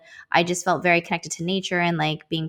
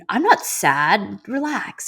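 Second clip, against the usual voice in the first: mock defensive denial; 2 semitones higher, slower than usual. To her son who says she's sad, mock defensive. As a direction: playfully insist you're fine. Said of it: "I'm all defensive".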